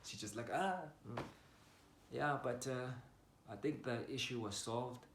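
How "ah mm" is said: imitating a careless, dismissive noise; higher-pitched verbal shrug, retold; mock indifference. Imitating the aunt who didn't give a shit, dismissive. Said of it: "didn't give a shit".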